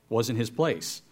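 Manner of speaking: ordinary talk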